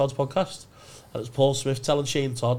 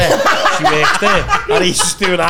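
slow, loud, laughing; laughing, drawn out; 5 semitones higher, 10 dB louder, much slower than usual. Retold or imitated. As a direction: stretch it out while laughing. Retelling pleading through laughter.

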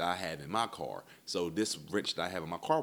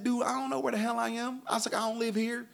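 very high-pitched, strained protest; exasperated reply; 3 dB louder than usual. Retold or imitated. Lost out-of-towner answers tow guy, exasperated. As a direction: retell it as a high, exasperated whine.

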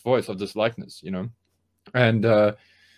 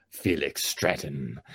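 low, slow gravelly soldier growl; Solid Snake voice; 9 semitones lower, 9 dB quieter, much slower than usual. Acted. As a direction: drop deep and drag it out. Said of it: "my Solid Snake voice".

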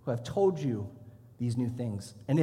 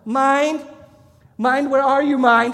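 high, loud calling out for a lost mind; recruit calling out; 9 semitones higher, 10 dB louder, slower than usual. Retold or imitated. Recruit forced to call for his mind, loud calling out. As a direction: shout into the hall as if searching. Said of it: "and he's going".

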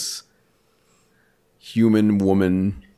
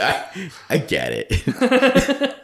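laughing; 9 semitones higher, much slower than usual. Retold or imitated. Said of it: "She was like".

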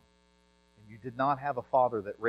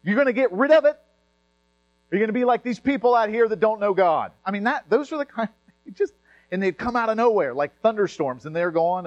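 fiery full-force sermon, much louder; preaching; 10 semitones higher, 11 dB louder than usual. Acted. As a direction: preach it hard and high.

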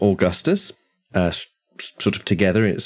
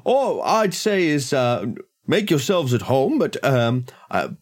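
higher-pitched, hesitant householder; politely guarded host; 6 semitones higher than usual. Acted. Host asks a guest to respect his privacy, polite high voice. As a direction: make a delicate request in a raised, courteous voice.

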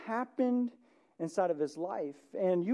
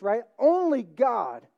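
ordinary delivery

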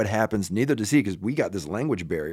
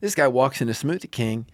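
usual speaking voice

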